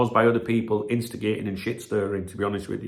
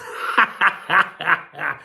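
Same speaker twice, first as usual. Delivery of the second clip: high staccato cackle; raised, chuckling laughter; amused laugh; 5 semitones higher, 3 dB louder than usual. Real, not acted. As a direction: burst into rapid ha-has. Laughing after the clip.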